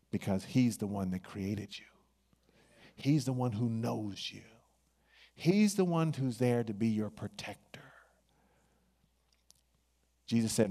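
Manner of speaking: ordinary delivery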